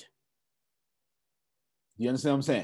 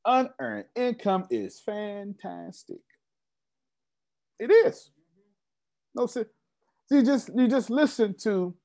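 ordinary talk

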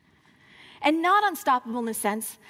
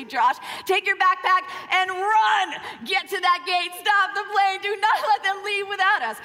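urgent shout; 6 semitones higher than usual. Retold or imitated. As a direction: yell at him to run and stop the plane. Urging husband to run for plane.

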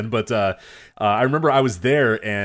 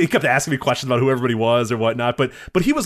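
ordinary talk, nothing marked

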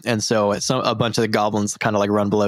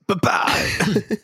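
Momo voice; 9 semitones higher than usual. Acted. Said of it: "just goes".

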